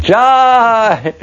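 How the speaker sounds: one high, ringing cheer; a crowd's burst of victory; triumphant cheer. Retold or imitated.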